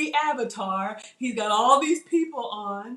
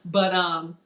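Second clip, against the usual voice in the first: ordinary delivery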